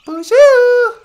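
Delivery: very high squeaky puppet wife greeting. Acted. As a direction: chirp it in a tiny high voice.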